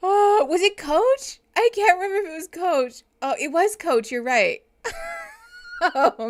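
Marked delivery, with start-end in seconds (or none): 0.1-4.1 excited. Real, not acted. Laughing excited.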